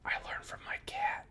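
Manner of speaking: soft, secretive whisper; conspiratorial whisper. Acted. The kid whispering the secret deal to the prisoner.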